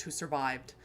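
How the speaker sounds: ordinary delivery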